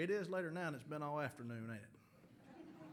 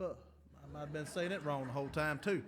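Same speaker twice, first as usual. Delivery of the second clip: ordinary talk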